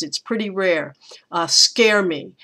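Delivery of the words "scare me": "scare me" with both words equally stressed, slow; spondee. Shown as a demonstration. Teacher showing students a spondee in the line. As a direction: give "scare" and "me" the same weight. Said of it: "I hear that as a, a scare me as a spondee".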